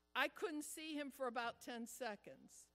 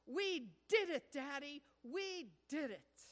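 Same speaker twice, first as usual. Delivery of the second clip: excited scream; 2 semitones higher, 6 dB louder than usual. Retold or imitated. Toddler running to his dad at the bottom, excited shout. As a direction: shout it out like a touchdown win. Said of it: "screaming at the top of his voice".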